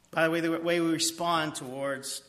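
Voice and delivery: ordinary talk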